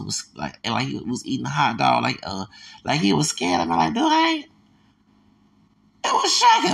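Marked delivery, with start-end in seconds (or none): 3.9-4.5 much higher, squeaky mock officer voice; mock scared squeak. 6.0-6.7 shocked exclamation. Real, not acted. Reacting to the officer.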